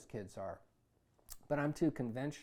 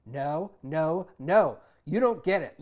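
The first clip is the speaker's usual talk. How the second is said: furious; 3 semitones higher, 11 dB louder, slower than usual. Acted. Son furious with mother.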